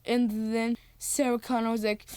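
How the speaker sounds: usual speaking voice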